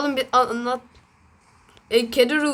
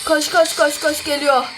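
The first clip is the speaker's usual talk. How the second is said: being chased; 3 semitones higher than usual. Real, not acted. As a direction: shout in a panic while running. Enemy chasing player again.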